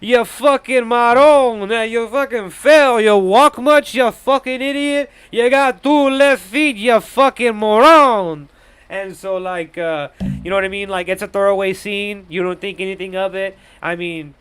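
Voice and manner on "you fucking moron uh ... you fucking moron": higher, louder, slow jeering mobster voice; mocking laughter. Dicky mocks Junior for slipping, loud jeering voice. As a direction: cackle and taunt him like a bully. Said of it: "starts, like, laughing at him".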